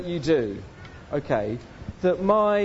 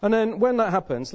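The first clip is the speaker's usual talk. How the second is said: ordinary delivery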